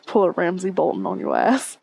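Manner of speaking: ordinary talk, nothing marked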